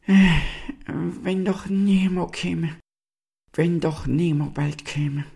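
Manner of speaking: high, drawn-out longing; deep sighing. A narrator's reading. Fading star longs for the stag to come, sighing deeply. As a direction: sigh it out slowly and high. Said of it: "seufzte manchmal tief auf".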